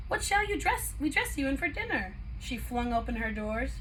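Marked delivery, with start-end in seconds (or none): 0.1-2.2 wardrobe voice. A narrator's reading. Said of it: "said the wardrobe carefully".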